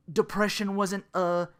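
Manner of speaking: ordinary delivery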